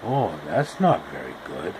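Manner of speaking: deep, hushed fatherly tut-tut; Uncle Henry voice. Acted. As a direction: grumble it low like a gruff old uncle. Uncle Henry voiced scolding Dorothy.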